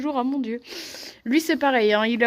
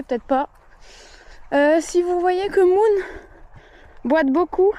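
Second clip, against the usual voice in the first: ordinary talk, nothing marked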